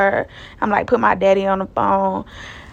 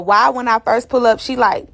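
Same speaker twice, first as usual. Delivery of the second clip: usual speaking voice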